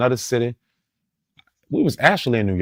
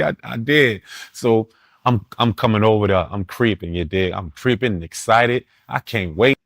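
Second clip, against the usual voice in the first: ordinary talk, nothing marked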